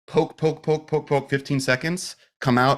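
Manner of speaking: high, light run of quick-fire jabs; acting out the jabbing. Acts out the needle procedure.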